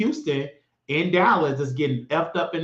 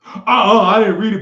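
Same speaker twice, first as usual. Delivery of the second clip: a loud mock "uh-uh" brush-off; mocking a dismissive fan; 3 semitones higher, 8 dB louder than usual. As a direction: play a know-nothing fan waving it away, loudly. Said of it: "but Taraji said".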